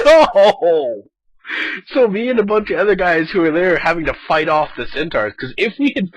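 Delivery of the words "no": loud, despairing no; dismayed cry. Retold or imitated. Player sees centaurs converge, loud dismay. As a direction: cry out as the horde arrives. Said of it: "And I'm like".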